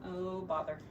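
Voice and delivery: normal talking voice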